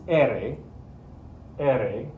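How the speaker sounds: "R" as Spanish E-re, one flap of the tongue tip; single-tap ere. Shown as a demonstration. Teacher demonstrating for students, single tongue flap. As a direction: tap once in "R", never roll it. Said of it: "tip of my tongue just goes bloop once".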